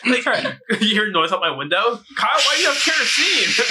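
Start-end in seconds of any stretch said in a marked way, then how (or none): 2.2-3.6 high, worried parent question; alarmed parent voice. Retold or imitated. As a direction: voice a suspicious mom. Voices a parent hearing a noise.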